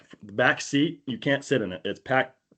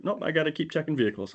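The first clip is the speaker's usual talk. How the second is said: higher, softer, quick brush-off; lightly voiced cop waving him off; female cop; 6 semitones higher, 5 dB quieter, faster than usual. Voicing the dismissive female cop.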